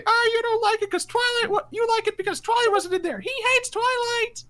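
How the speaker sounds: very high mock falsetto whine; squeaky imitation of an indignant fan; protective Twilight fan. Imagined fan accusing the speaker of Twilight hate, protective and high-pitched. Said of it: "someone who's very protective of Twilight could say".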